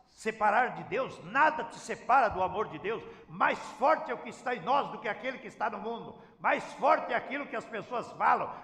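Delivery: normal talking voice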